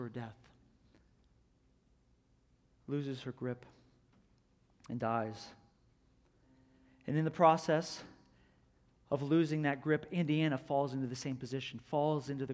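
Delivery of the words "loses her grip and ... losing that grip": usual speaking voice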